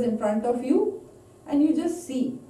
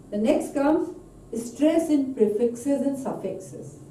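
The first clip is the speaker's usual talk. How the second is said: usual speaking voice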